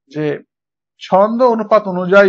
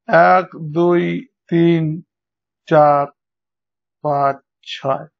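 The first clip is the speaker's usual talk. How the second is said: slow counting; much slower than usual. Shown as a demonstration. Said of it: "walk দুই নম্বর syllable".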